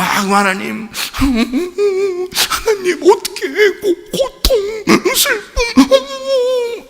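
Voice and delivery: high, drawn-out acted sobbing; exaggerated crying plea; mock tearful prayer. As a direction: wail slowly to God in hammed-up misery. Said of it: "얼굴 표정 보니까 진짜 슬프잖아".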